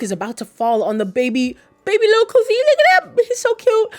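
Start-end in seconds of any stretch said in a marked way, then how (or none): 1.8-3.9 very high, squeaky gushing. Real, not acted. Fan gushing over the little baby, squeaky cooing. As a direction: coo over something tiny and adorable.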